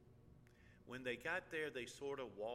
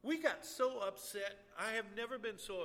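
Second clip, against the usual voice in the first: impassioned; 10 semitones higher, 8 dB louder than usual. Real, not acted. Emotional peak.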